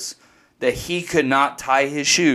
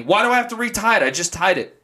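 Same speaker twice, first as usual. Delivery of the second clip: fast, high mock whine of a boy; whining kid; 8 semitones higher, faster than usual. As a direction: mimic the son protesting, pitched up and quick. Son voiced in story.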